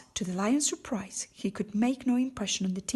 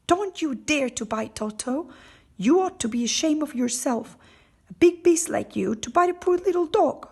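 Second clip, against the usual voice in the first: loud, scolding shout rising higher; cried out; 6 semitones higher, 8 dB louder than usual. A narrator's reading. Dorothy shouting at the lion who tried to bite Toto, angry. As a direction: scold the lion fiercely, voice climbing. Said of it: "while she cried out".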